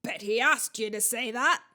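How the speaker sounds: loud, scornful huntsman; yelled jeer. A narrator's reading. Huntsman's head scoffs at the boy, yelling. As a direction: call his bluff loudly. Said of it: "yelled Sir Patrick's head".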